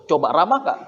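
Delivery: ordinary talk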